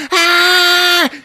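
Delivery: high, loud, held mock scream; hammed-up long shriek; scream of alarm. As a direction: scream as if about to crash. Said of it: "And he's like".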